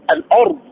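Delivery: الأرض with a thick, vowelless R; heavy silent-vowel ra. Shown as a demonstration. Teacher gives students an example, heavy R in الأرض. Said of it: "تلوناه مفخما".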